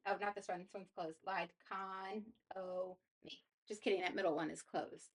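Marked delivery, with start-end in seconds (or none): 1.3-3.4 Lied, con, o, me said apart; syllable by syllable. Shown as a demonstration. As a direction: break Economy into beats.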